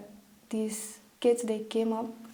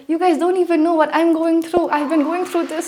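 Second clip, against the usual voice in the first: loud, high, exasperated venting; frustrated outburst; 7 semitones higher, 10 dB louder than usual. Retold or imitated. To complaining friends, a loud frustrated outburst. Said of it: "I bursted out".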